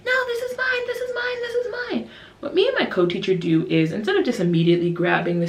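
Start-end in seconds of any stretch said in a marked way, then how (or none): 0.1-2.1 very high, whiny-kid squabble, imitated; mock child tantrum in a squeaky high pitch; arguing child. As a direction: squabble like a small child claiming a toy. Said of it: "really fighting and really arguing".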